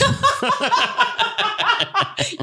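long, high burst of laughter; big laugh. Real, not acted. Co-hosts on the poo-in-pocket joke, long high laughing.

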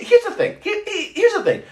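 high, laughing start; real laughter breaking into the words. Real, not acted. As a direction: laugh through the opener. Excited laughing start to the story.

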